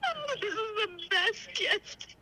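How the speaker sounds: high, soft and slow through tears; tearful gratitude. Real, not acted. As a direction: overcome with real joy, barely getting it out. Tearful winner thanking the hosts.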